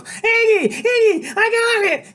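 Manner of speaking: high, loud eager shout; excited high woman. A narrator's reading. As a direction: burst out with the idea. Loretta excited.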